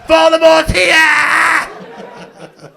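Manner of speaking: very slow, high, loud menacing shout; hammed-up scary wail through a mask; loud drawn-out ghoulish cry; monster voice. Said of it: "wandered into the tent like".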